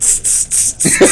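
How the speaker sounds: voiceless hissing laugh in bursts; salt-shaker hiss of a held-in laugh; imitated stifled laugh. Shown as a demonstration. Showing how his teased friend began laughing, hissy and stifled. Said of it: "he started laughing like this".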